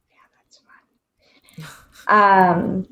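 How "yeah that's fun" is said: sarcastic whisper. Real, not acted. Sarcastic aside about busy moms.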